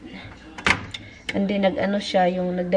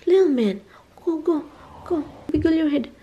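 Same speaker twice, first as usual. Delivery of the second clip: playful coaxing; 8 semitones higher than usual. Real, not acted. Parent urging little man to wiggle free, playful coaxing. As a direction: egg a little one on in a high voice.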